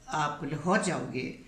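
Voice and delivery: ordinary talk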